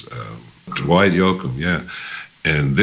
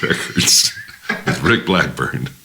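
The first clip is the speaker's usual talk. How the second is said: ordinary delivery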